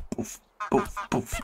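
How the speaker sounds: soft mock punch noises. Sound effects while punching.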